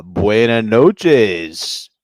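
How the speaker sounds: put-on very slow, loud Spanish-accented announcer voice. Closing announcement to Spanish customers, in a mock Spanish accent. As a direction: drawl it out in a hammed-up Spanish accent. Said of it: "tried my Spanglish at 'em".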